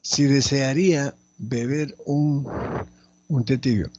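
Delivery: slow, loud dictation with pauses; teacher reading out slowly and loudly. Shown as a demonstration. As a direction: dictate it firmly, phrase by phrase. Dictating the exercise sentence.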